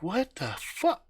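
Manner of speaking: high, slow, drawn-out what the fuck; stunned disbelief. Acted. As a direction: gasp it out in disbelief. Stunned guys see silhouette.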